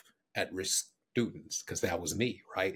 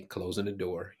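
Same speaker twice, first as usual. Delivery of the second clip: ordinary talk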